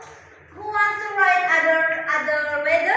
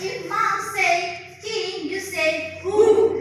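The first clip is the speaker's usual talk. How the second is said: slow call-and-response rules; much slower than usual. Shown as a demonstration. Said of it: "the ice breaking is if".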